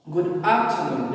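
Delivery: "afternoon" stressed on its opening A, then falling. Shown as a demonstration. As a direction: lean slowly on the AF of "afternoon". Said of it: "di A".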